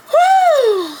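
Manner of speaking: high loud whew against tears; whew to hold back tears. Real, not acted. Fighting tears on camera, a high loud whew. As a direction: blow out a high whew to stop crying. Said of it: "How am I getting emotional?".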